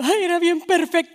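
exaggerated wailing mourner; mock weeping. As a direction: lament like a tearful widow, hammed-up. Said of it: "Y luego me andan llorando".

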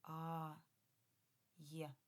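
ordinary delivery

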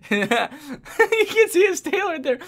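high, slow words through laughter; laughing. Real, not acted. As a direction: keep laughing while pointing him out. Laughing during the clip.

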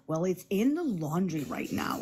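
whispered aside; hushed whisper to the viewers. Real, not acted. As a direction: whisper it like a confession.

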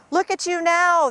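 high, loud taunt; jeering yell. A narrator's reading. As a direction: yell it mockingly at him. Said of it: "they yelled at him".

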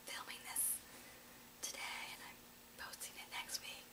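hushed secret whisper to the camera; whispered aside. Real, not acted. Vlogger letting viewers in on a secret, whispering. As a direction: lean in and whisper it like a confidence. Aside about filming ahead.

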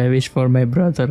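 usual speaking voice

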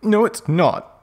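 high, mildly angry protest; half-hearted high shout. A narrator's reading. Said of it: "I shouted angrily".

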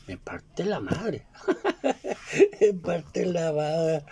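laughing through it, slow and drawn out. Real, not acted. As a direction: laugh at the near miss while saying it. Joking about nearly being detained. (riéndose despacio)

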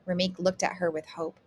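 ordinary delivery, nothing marked

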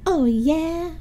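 high, mildly venomous retort; hissed retort. Acted. Rhonda answers Helga's threat, high and mildly hissing. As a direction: throw it back at your rival. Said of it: "Rhonda hissed".

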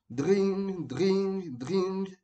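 high, sustained ringing "dring"; imitated telephone ring. A narrator's reading. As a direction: trill three long high rings like an old telephone. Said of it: "la sonnerie du téléphone".

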